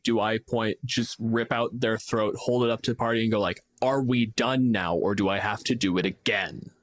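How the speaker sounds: usual speaking voice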